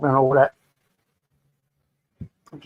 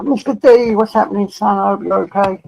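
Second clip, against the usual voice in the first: excited greeting; 6 semitones higher, 6 dB louder than usual. Real, not acted. Greeting a viewer by name.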